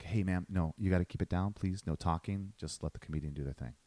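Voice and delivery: imitating himself quietly asking a heckler to stop; calm hushing usher. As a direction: settle her down gently, quietly. Calming a heckler in the crowd.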